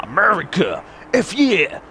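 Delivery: hammed-up, loud, high American cheer; mock patriotic cheer. Vlogger playfully salutes American subscribers, hammed-up cheer. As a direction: whoop it up for America, tongue in cheek.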